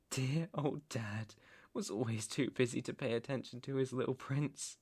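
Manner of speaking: soft aside about a neglectful father; quiet bitterness. Acted. Crime lord's son recalling neglectful dad, quiet bitterness. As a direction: say it softly, under the main story.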